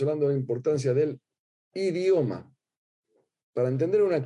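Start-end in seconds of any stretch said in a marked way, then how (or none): 1.7-2.5 "idioma" set off after a pause and pitched higher; pause, then hit "idioma" up high; stress on idioma. Shown as a demonstration. Lecturer contrasting language with "idioma", pitched up after a pause.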